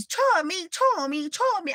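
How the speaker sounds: high sing-song call of his name. Retold or imitated. Calling her boyfriend's name from outside the gate, high and carrying.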